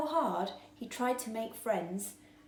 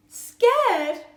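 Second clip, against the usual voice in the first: much higher and louder; alarmed; 13 semitones higher, 15 dB louder than usual. A narrator's reading. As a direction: blurt it in shock. Alarmed question.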